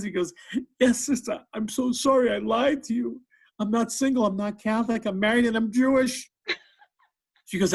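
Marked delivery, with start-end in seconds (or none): 0.8-6.3 high-pitched, hammed-up crying; mock tearful confession. Cab driver confesses his lie to the nun, mock crying. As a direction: wail it in a high voice, as if confessing in tears. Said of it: "He starts crying his eyes out".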